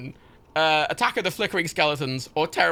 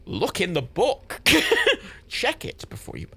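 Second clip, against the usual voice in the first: normal talking voice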